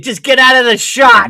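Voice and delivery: loud, high mock yell at the child; shouting from off-camera. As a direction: bark it like a frazzled parent. Mock-yelling at a kid on TV.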